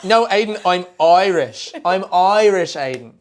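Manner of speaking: loud, high imitation of exasperated Irish girlfriend; Irish accent. Girlfriend scolding boyfriend over his accent, indignant mock-Irish. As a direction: protest in a hammed-up Irish accent. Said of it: "But she's like".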